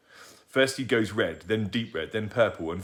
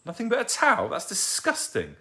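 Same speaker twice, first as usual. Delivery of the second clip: mock shocked; 14 semitones higher than usual.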